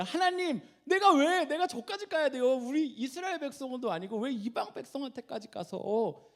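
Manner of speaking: high, loud, whining complaint to God; disgruntled protest. Acted. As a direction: act out an indignant "why me?" objection. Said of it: "요나가 딱 그 표정이에요".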